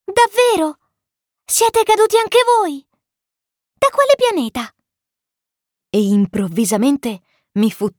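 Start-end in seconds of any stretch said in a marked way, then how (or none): 0.0-4.7 very high little prince voice, astonished; wide-eyed amazement. A narrator's reading. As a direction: ask in a small, amazed child's voice. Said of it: "Il piccolo principe sgranò gli occhi". (vocina acuta piena di stupore)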